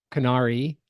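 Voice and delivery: ordinary talk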